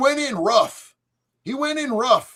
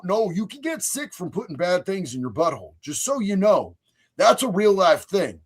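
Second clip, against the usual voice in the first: ordinary talk, nothing marked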